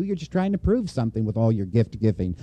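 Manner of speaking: ordinary talk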